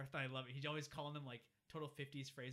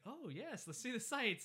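eager agent; 10 semitones higher, 4 dB louder, slower than usual. Retold or imitated. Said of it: "And he's like".

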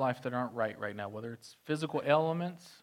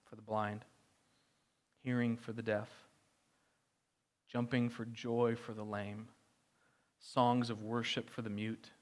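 much softer, lower, unhurried; soft tender preaching; 3 semitones lower, 5 dB quieter than usual. Acted. Pastor comforting his flock, soft and tender. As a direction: let the promise sink in gently.

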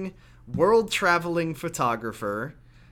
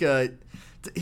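ordinary talk, nothing marked

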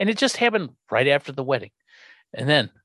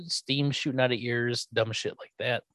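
normal talking voice

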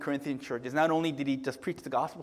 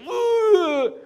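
high anguished wailing cry; wailing groan; 8 dB louder than usual. Retold or imitated. Pastor acting the convert's wail after hearing God, high cry. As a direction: burst out in a mock wail. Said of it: "he starts crying and wailing".

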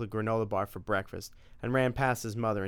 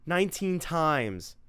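proud; 6 semitones higher, slower than usual. Acted. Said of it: "said Tim proudly".

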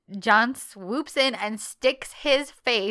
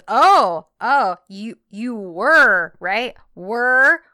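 teasing disbelief; 3 semitones higher, 8 dB louder, much slower than usual. Retold or imitated. Teasing quote in retold scene.

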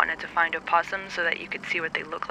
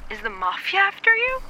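much higher, louder startled question; alarmed; 12 semitones higher, 4 dB louder than usual. Acted. Friend hears about the Mafia, alarmed. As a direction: gasp it out in alarm.

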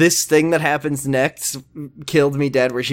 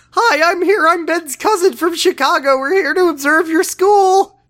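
much higher hammed-up teen girl voice; mock Dylan; 4 dB louder than usual. Host mocking Dylan's excuse to a teacher, much higher and hammed-up. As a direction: overdo a fake-innocent cover story. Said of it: "is like".

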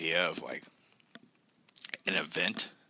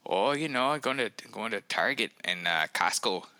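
lilting, higher-pitched Minnesota locals; Minnesota accent; 5 semitones higher, 3 dB louder than usual. Retold or imitated. Transplant imitating how people here chat, in a Minnesota accent. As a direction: put on a folksy Minnesota accent. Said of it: "here it's like people are just like".

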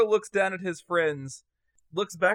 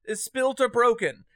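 pleading; 7 semitones higher than usual. Acted. Marco pleading with Digby to come out.

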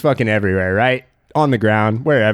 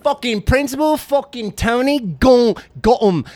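cult leader rant; 6 semitones higher than usual. Acted. Cult leader rant about principal.

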